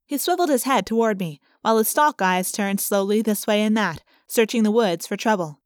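ordinary delivery, nothing marked

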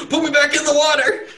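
high, loud comic shriek; mock panicked yell. Captive to the big orc staring down, mock panic. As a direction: yelp it in hammed-up terror.

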